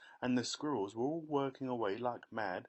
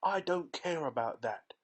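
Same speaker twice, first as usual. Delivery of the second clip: high, loud defiant shout; spoiled girl shouting at her mother; bratty shout; 6 semitones higher, 5 dB louder than usual. A narrator's reading. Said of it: "shouted Veruca".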